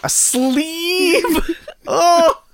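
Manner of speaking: high squealing laugh. Real, not acted. Frog sleeve joke.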